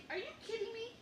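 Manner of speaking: high, stunned are you kidding me; outraged disbelief. Retold or imitated. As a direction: shriek it in disbelief at the form. Her outrage at the guilty plea box.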